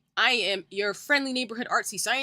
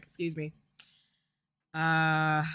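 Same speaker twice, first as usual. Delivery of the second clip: usual speaking voice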